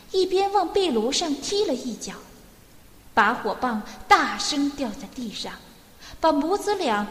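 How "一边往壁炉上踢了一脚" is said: ordinary delivery, nothing marked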